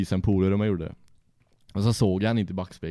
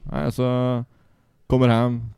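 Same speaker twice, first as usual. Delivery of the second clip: ordinary delivery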